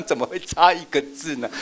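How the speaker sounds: high, put-on voice of shock; hammed-up disbelief; mock astonished teacher. Shown as a demonstration. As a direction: act amazed at the missing word. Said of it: "你就这样".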